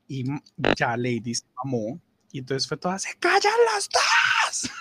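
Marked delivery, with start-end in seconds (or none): 3.0-4.7 much higher, slow, drawn-out shout; fed-up shout. Retold or imitated. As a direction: slam the argument shut. Manager fed up with the argument.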